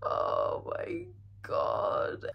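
soft, high, whimpering whisper; crying whisper. Real, not acted. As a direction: breathe the name out through tears. Grieving over character Daisy.

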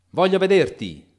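shouted high and loud; loud demand. A narrator's reading. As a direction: demand it through the door. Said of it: "gridò Utterson a voce altissima". (grido alto e imperioso)

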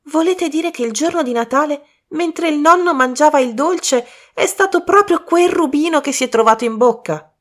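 high, incredulous rush of disbelief; astonished question. Acted. Colin asks Poirot, astonished, in high disbelief. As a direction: Colin can hardly believe it, voice up high.

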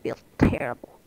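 breathy whisper under the breath; voiceless aside. Real, not acted. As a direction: whisper it as a private grumble. Muttered reaction, then repeated voiced.

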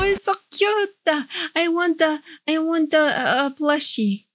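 usual speaking voice

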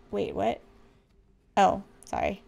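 normal talking voice

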